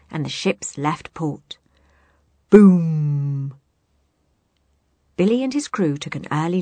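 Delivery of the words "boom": loud booming cannon blast. Acted. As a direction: boom it out like a cannon. Cannon boom sound effect.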